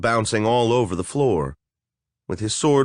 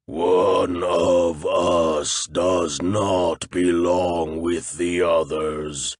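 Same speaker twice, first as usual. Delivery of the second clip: lower, very slow monster voice; wet monster voice; 5 semitones lower, much slower than usual. Acted. A flesh golem setting a riddle for intruders, slow and lower. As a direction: drag each word out like a lumbering golem. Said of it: "Its breath bubbled wetly".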